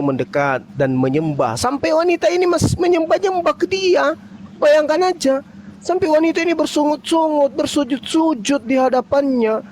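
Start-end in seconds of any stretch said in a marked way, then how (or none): 1.5-9.6 much higher, worked-up retelling; outraged and emphatic; indignant. Real, not acted. (nada tinggi penuh kesal)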